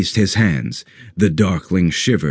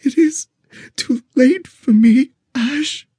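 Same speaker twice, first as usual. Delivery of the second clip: bleeding out. Acted. Dessa dying in Ash's arms.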